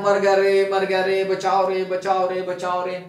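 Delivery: loud panicked cries of मर गया रे, fading at the end; a frightened biker shouting for help in the forest, mock yelling; retold cries for help. Yell it like you're trapped, then let it fade. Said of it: "और चिल्ला जा रहा है".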